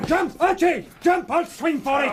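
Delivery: high urgent yell to his partner; panicked warning cry; desperate shout. Acted. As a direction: scream at him to get away. Clay warning accomplice to flee.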